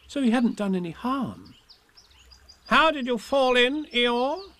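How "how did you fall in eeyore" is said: high, loud questioning voice; high-pitched busybody animal; Rabbit. A narrator's reading. Rabbit questioning Eeyore after the river, high voice. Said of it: "asked Rabbit".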